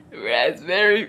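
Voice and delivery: low put-on man's voice; mock a man savouring the word; dessert man. Man smirking while naming desserts, low mock voice. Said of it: "While he's talking".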